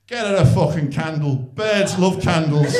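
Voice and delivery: loud, slow broad Scouse advice; broad Scouse accent. Retold or imitated. Friend gives blunt gift advice, loud slow Scouse. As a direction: bellow it slowly in broad Scouse. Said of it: "in the broadest Scouse accent".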